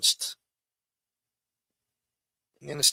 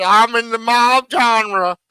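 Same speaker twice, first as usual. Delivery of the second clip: mock yokel drawl; 7 semitones higher, 5 dB louder, slower than usual. Mocking rival, drawn-out repeat.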